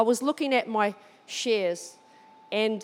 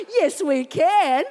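laughing yes; 3 semitones higher, 6 dB louder, much slower than usual. Retold or imitated. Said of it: "agreeing to catch up, thrilled".

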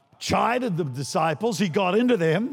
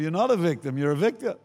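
ordinary talk, nothing marked

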